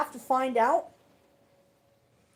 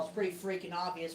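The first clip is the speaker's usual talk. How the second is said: ordinary delivery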